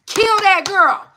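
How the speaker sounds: high, loud, slow command; fierce command. Real, not acted. Urging viewers to kill their inner weakness, loud and slow. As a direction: hit it hard and slow.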